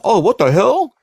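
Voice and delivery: high, startled yelp of disbelief; startled outburst. Retold or imitated. Dennis startled by a ghostly voice, high and shocked. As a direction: jump at a sudden voice. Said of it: "he just goes".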